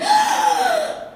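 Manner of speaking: sharp high gasp for air; a dramatic, staged gasp; loud gasp. Acted. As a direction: gasp loudly as if drowning. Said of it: "I mean, we gasp".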